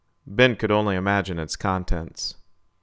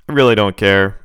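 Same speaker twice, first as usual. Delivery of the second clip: put-on louder, cutting the old man off; loud dismissal; 9 dB louder than usual. A narrator's reading. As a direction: snap it out loud. Ben loses patience.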